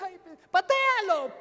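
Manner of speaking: loud, high, slow reluctant cry; loud slow exasperated surrender; exasperated giving in. Retold or imitated. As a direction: give in with a groan. Reluctant resignation.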